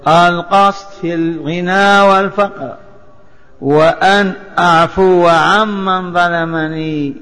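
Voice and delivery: usual speaking voice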